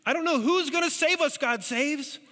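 high desperate cry, ironic; panicked Ahaz. Acted. King Ahaz pleading with God Saves, high and panicked. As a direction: repeat the frightened plea, making the irony land. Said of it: "Are you getting the irony?".

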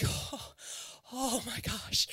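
breathy exhausted whisper of a tired parent; weary whisper. Retold or imitated. Mother worn out by spoon feeding, whispering. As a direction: sigh it out under your breath, worn out. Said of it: "I was like".

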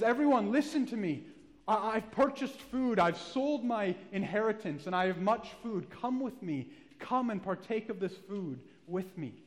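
loud, high call to a starving crowd; urgent preacher's cry of invitation. Acted. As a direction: raise the voice and summon everyone to the food. Man calls out in the marketplace.

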